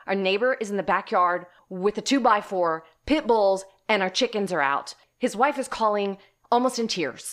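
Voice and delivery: loud, high, rushed urgency; urgent panic. Retold or imitated. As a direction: order the husband home in alarm. Panicked call to husband.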